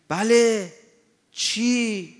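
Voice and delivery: mock loud call of a deaf old cleric; loud hard-of-hearing reply. Imitating the old cleric answering the official, loud. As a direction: shout it like someone who can't hear.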